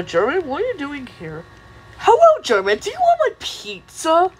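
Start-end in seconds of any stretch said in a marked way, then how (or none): 2.0-4.3 high, eager cartoon seller; excitable high-pitched cartoon greeting; pushy pizza pitch. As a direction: chirp the offer way up high. Pizza character makes the offer.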